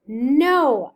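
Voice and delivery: loud shouted no; screamed. Acted. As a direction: yell it right at him. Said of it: "I screamed at him".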